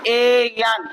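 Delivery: very slow, letter by letter; slow spelling. Shown as a demonstration. Teacher spelling Kiran for pupils, letter by letter. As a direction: spell each letter out for the class. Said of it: "किरण लिखोगे तो क्या हो जाएगा".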